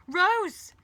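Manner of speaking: high, loud cry of the name; full-voiced anguished yell; desperate shout. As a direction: call out desperately after someone leaving. Calls after departing Rose.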